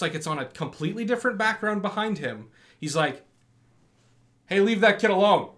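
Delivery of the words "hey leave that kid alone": higher, loud mock-heroic call; old man calling out. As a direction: step in and call it out. Old man intervenes in movie.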